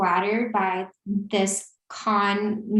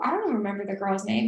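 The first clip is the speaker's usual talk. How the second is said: normal talking voice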